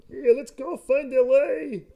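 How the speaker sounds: high, slow hammed-up Russian accent; bad Russian accent. Mocking the Russian sub crew in a bad Russian accent. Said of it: "That was my Russian".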